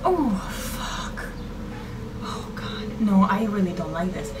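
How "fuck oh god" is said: breathy whispered dread. Real, not acted. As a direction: breathe it out scared. Frightened gasp.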